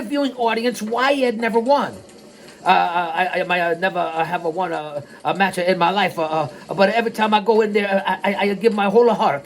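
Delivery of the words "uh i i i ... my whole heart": stammering, defensive mock San Juan accent; badly faked San Juan accent, extra vowels tacked on; Frankie Williams impression. Wrestler defending his losses, halting mock San Juan accent. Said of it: "Williams said defensively".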